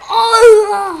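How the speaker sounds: high, loud cry half laughing; a screaming laugh-cry of shock; startled scream. Real, not acted. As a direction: shriek in shock at a bomb. Killed again by bomb.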